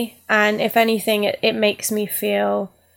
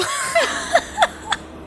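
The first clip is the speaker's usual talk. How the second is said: burst of laughter. Real, not acted. Ashes lost in the mail.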